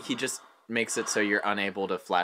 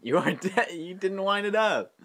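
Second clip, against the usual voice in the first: laughing taunt; 8 semitones higher than usual. Real, not acted.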